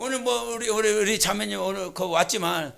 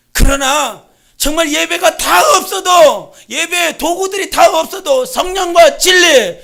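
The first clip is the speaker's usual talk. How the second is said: preacher shouting the point home; full-force preaching; 4 semitones higher, 9 dB louder than usual. Acted. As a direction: proclaim it to the whole church, voice raised high.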